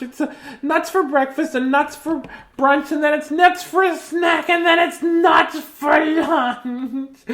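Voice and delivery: high, loud squirrel, slowing to the end; loud squirrel rant. Acted. Reading to kids as a loud, fed-up squirrel. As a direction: shout the nut list like a fed-up squirrel.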